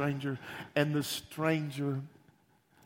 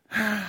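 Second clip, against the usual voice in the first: higher and louder on Three times; emphatic repeat; 6 semitones higher, 7 dB louder than usual. Real, not acted. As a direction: hammer the words home. Emphatic repeat of denial count.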